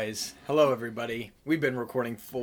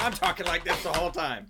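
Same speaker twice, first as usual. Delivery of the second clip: higher-pitched mock loud-talker voice; imitating loud talker; 7 semitones higher than usual. Teasing the co-host who gets into it, mock loud voice. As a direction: mimic someone getting carried away, voice pitched up. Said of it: "am I talking way too loud".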